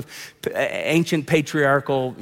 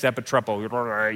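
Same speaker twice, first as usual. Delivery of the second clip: name-dropping; 6 semitones lower, 4 dB quieter, slower than usual. Pretend. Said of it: "as I was studying the ancient Greek text".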